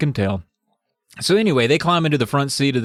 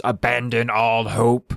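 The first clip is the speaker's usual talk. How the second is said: slow, spooky announcer read; ominous sign voice; 2 semitones lower, slower than usual. Acted. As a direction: intone it like a haunted sign. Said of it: "and it says".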